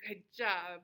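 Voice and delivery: ordinary talk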